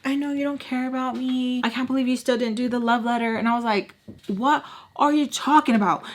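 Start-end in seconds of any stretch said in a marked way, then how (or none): 0.0-3.4 high, self-pitying mock of him; mocking boy. Boy making it about himself, mockingly imitated. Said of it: "make it about him". 4.3-6.0 high, incredulous retort; indignant disbelief. Retold or imitated. Girl answering his love letter complaint, indignant. Retold indignant reply to boy.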